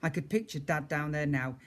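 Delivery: plain reading voice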